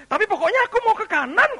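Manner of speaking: high, fast acted insistence; stubborn outburst. Wilful one insisting on going his own way, stubborn outburst. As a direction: blurt it out loudly, refusing to budge.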